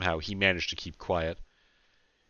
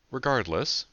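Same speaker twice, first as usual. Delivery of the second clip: smooth, higher-pitched interjection; 6 semitones higher, 4 dB louder than usual. A narrator's reading. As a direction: step in smoothly, voice lifted. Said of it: "she said smoothly".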